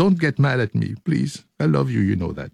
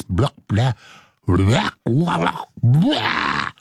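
silly blah blah ending in a high squeak; mock babble; 4 semitones higher, much slower than usual. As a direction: babble nonsense then squeal. Joking filler with squeal.

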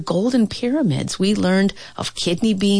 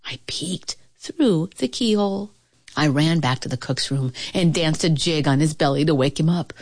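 ordinary delivery

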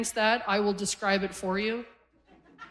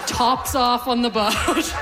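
emphatic mock outburst; 5 dB louder, slower than usual. Aunt's exasperated quote to young nephew.

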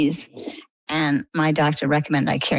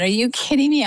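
disbelief; 8 semitones higher than usual. Retold or imitated. Retold shock at the diagnosis.

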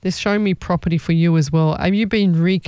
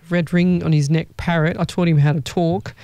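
ordinary talk, nothing marked

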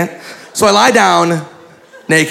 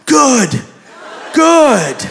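high, insistent backpedaling; over-eager, guilty reassurance; 9 semitones higher, slower than usual. Retold or imitated. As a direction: hurry to reassure, pitch way up. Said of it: "felt really guilty".

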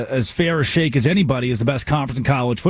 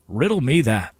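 ordinary talk

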